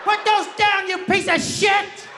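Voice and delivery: very high, screechy held notes; outraged old lady. Acted. Old lady to man picking up her vegetables, screeching outrage. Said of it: "she looked at me and she was like".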